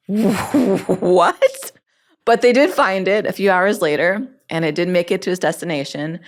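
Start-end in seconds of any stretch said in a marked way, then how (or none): 0.1-1.7 high, loud, stretched disbelief; a coworker who can't believe her ears; loud and high, the cube mate's stunned what; shocked what. Retold or imitated. Cube mate reacts in shock.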